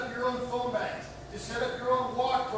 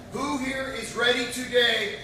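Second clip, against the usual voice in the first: slow, loud drawn-out rally chant; roaring rallying speech; 4 semitones higher, 16 dB louder, slower than usual. Real, not acted. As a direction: lead the crowd in a roar.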